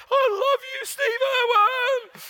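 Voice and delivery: high, loud, drawn-out cry of love; loud declaration. Retold or imitated. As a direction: belt it out like a fan across the plane. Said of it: "just about stood up ... and said".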